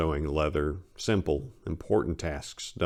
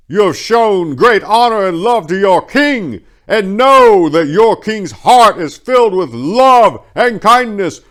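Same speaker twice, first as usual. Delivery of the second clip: much higher, much louder royal proclamation, slow on key words; booming king; 13 semitones higher, 16 dB louder than usual. A narrator's reading. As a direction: address the whole city from a high platform. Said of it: "His voice boomed through the city from on high".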